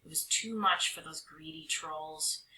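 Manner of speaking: usual speaking voice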